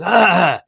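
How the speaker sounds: loud, high sharp yelp; one puppy warning another with a loud yelp; startled puppy yelp. Retold or imitated. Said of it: "kinda goes".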